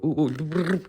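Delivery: high quick "ooh, ooh"; acted little oohs of a new idea; mock excited oohs. Vlogger mimicking their own reaction, high excited oohs. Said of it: "within about half a second I go".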